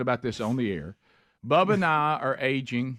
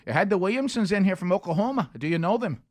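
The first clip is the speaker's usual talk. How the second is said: put-on Irish woman's accent; 7 semitones higher than usual. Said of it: "that woman at the wool place ... said".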